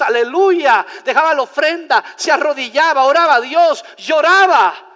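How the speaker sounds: loud, high, punchy sermon storytelling; forceful preaching. Real, not acted. Preacher recounting a man's wild worship to congregation, forceful preaching. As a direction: pile up each action with rising fervor.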